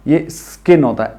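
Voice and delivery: स्किन said with no vowel before the s; right pronunciation. Shown as a demonstration. Teacher showing the class the right स्किन. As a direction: start स्किन straight on the s, no "i" sound. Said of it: "इतना ज्यादा ई मत करिए".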